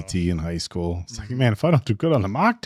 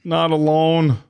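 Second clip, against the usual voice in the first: alarmed warning; 9 semitones higher, 5 dB louder, much slower than usual. Real, not acted. Warning a drama character.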